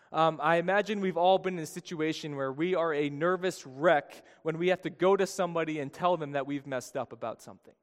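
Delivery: usual speaking voice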